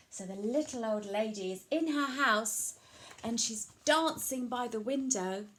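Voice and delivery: usual speaking voice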